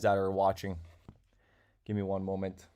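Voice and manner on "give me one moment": distracted, quiet stalling. Real, not acted. Stalling while busy with giveaway.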